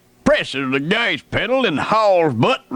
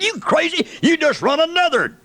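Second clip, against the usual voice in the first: alarmed outburst; 9 semitones higher, faster than usual. Retold or imitated. Panicked yell at reckless driver.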